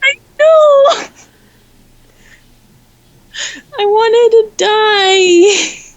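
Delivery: high, slow, teary laughing wail; half laughing, half sobbing over it; laugh-crying. Real, not acted. Friend recalls being pranked about Mark Hamill, laugh-crying.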